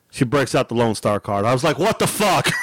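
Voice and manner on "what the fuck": mock shout of disbelief; outraged outburst. Shopper seeing the Lone Star card, outraged outburst. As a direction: burst out, retelling your outrage.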